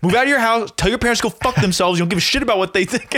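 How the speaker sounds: Gary Vee. Retold or imitated. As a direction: fire off blunt mock advice. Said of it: "he's like".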